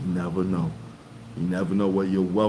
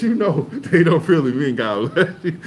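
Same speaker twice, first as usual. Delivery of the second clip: higher, louder, laughing punchline; retold joke, half laughing; laughing through it; 8 semitones higher, 6 dB louder than usual. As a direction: crack up while delivering it. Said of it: "she said".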